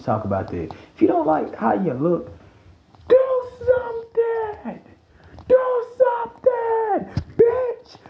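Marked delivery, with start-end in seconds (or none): 3.1-8.0 high, drawn-out mock yell; mock shouting. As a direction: scream it at them, hammed-up and slow. Yelling at insecure listeners.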